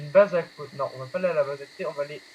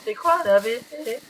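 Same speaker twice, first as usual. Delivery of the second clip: high, slowed-down mock question; put-on higher voice for a quoted viewer; imagined questioner; 6 semitones higher than usual. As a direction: imitate someone asking a silly question. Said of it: "celui qui me demande".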